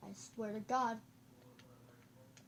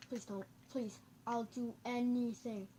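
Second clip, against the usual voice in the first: ordinary delivery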